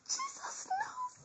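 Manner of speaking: very high, slow falsetto; falsetto aside. Pretend.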